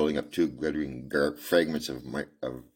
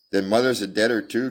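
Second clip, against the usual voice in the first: cried; 6 semitones higher, 5 dB louder than usual. Acted. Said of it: "cried the little girl".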